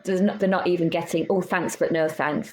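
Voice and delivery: normal talking voice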